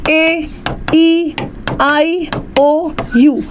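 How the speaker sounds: letters spoken slowly one by one; vowel list drawn out. Shown as a demonstration. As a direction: spell the vowels out slowly for the class. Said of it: "vowel क्या होते हैं हमारे?". (स्वरों को धीरे-धीरे गिनाना)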